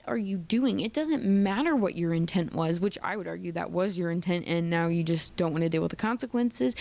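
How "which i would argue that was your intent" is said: ordinary delivery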